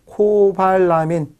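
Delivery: stretched-out, slowly sounded syllables; slow dictation. Shown as a demonstration. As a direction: draw the word out for note-takers. Dictating a term to write down.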